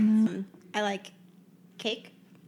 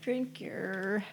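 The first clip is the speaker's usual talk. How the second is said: ordinary talk, nothing marked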